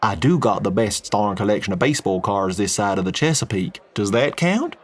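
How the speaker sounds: easy Texan oil man, friendly and blunt; a folksy Texan businessman chatting. Acted.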